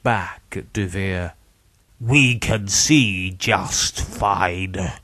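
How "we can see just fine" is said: slow, eerie many-in-one voice; possessed victim. A narrator's reading. Dead victims answering through Charles, slow possessed voice. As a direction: speak slowly as the spirits inside him.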